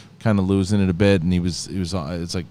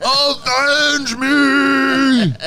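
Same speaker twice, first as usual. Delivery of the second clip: high, strained dying cry; overacted death scene; 4 dB louder, much slower than usual. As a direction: wail it out like a dying hero. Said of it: "do you remember Harry Dean Stanton's line".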